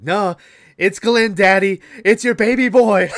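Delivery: put-on high, loud, eager boyish voice, laughing; hammed-up eager son calling his daddy; excited son. Son proudly telling his father, high eager voice.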